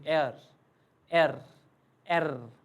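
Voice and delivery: एयर clipped short to एर. Shown as a demonstration. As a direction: say एर fast, one quick syllable. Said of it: "but थोड़ा जल्दी".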